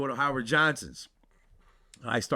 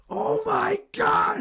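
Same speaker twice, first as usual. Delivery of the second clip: very high slow shouted scream; high drawn-out panicked yell; terrified shriek; 5 dB louder, much slower than usual. Retold or imitated. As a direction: shriek in horror at the rat. Brother turns into rat.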